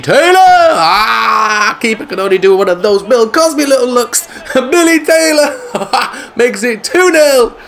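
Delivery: high cry into slow laughing commentary; slowed, laughing celebration of the goal. Real, not acted.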